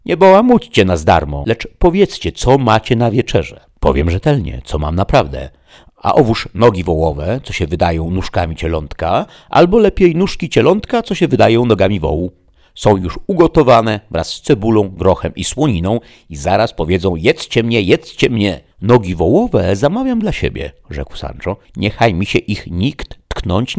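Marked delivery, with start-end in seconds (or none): 0.1-3.6 loud, high demand; raised-voice squire's protest; exasperated cry. Acted. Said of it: "zawołał Sancho". 3.8-18.6 loud, animated sales patter; louder, emphatic innkeeper voice; talkative innkeeper, eager to sell. Acted. Innkeeper talks up his only dish. 18.8-20.7 loud, high, eager squire; eager claim. Acted. Sancho eagerly claims the dish.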